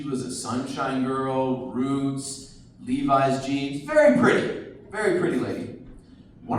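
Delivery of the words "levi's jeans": ordinary talk, nothing marked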